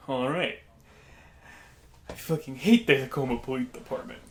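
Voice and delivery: lightly voiced odd man, restrained; odd customer impression. Odd stranger repeating his complaint to the friend, lightly imitated. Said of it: "It's how he sounded".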